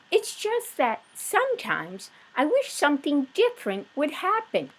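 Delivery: high, bright wife voice; voiced Mrs Watson. Wife musing to husband, high and light. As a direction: pitch up as the wondering wife.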